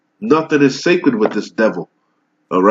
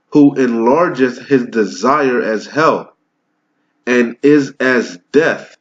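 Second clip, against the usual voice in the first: slow, deliberate scripture reading, each phrase spaced out; read slowly; slower than usual. Shown as a demonstration. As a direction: read it again slowly so every clause sinks in. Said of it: "I'll read that over again slowly".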